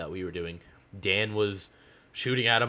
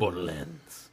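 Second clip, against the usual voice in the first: Spanish accent; 3 semitones lower, 3 dB quieter, much slower than usual. Real, not acted. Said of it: "Why did I sound Spanish?".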